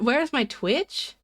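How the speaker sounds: slow drawn-out question. Retold or imitated. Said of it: "Say what?".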